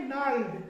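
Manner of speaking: नर्ल्ड with silent G, starting on N; correct pronunciation. Shown as a demonstration. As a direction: say नर्ल्ड as "narld", no G. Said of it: "इसको पढ़ते हैं".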